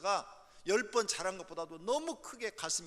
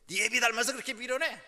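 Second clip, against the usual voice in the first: put-on loud, contemptuous jab at the kid; mother insulting the child; 6 semitones higher, 5 dB louder than usual. Mother belittling the child by comparing him to his father, raised and scornful. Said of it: "애 자존심을 막 건드는 말".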